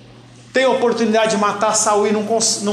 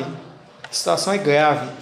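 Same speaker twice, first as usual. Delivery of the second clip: grave and heavy; 5 semitones lower, slower than usual. Shown as a demonstration. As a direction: let it sink low and heavy.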